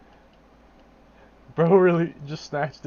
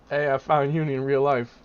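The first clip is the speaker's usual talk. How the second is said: ordinary talk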